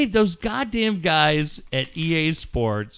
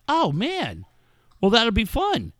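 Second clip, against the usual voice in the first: excited son; 8 semitones higher than usual. Retold or imitated. Son thrilled about the Sharks.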